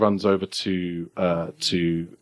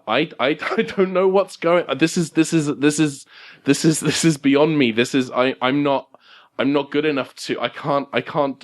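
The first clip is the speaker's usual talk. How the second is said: higher, stammering overwhelmed man; flustered Larry; 4 semitones higher than usual. Acted. Larry, out of his depth as he fails, flustered and stammering. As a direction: stammer it out, at a loss. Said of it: "and Larry says".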